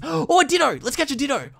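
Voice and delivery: high, gasping delight at a rare find; excited gasp. Real, not acted. Gamer spotting a wild Ditto, high excited gasp. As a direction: gasp and brighten up.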